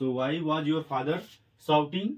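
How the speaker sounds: slowed right down, words spaced; slow dictation. Shown as a demonstration. Teacher dictating the question to students, slowly. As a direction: let them write as you speak. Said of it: "आपके पापा इतनी जोर से".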